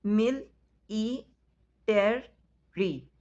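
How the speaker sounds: "मिल- ई- टर- री" as four separate beats with pauses; syllable by syllable. Shown as a demonstration. Teacher to pupils, counting syllables of military, beat by beat. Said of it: "syllable count हैं वो four बनते हैं".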